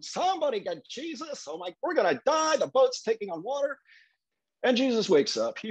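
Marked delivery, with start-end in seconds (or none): 0.0-1.5 high, half-acted frantic call; a scared sailor yelling for help; panicked disciples. Disciples freaking out, calling for Jesus in high mock panic. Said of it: "They're running around going". 1.8-3.8 high mock-panicked wail; the boat is sinking, sell the fear lightly; freaking out. Disciples in the storm crying out in high mock panic. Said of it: "So they're freaking out".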